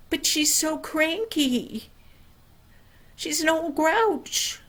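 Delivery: put-on whiny boy. Bill complains about the neighbor.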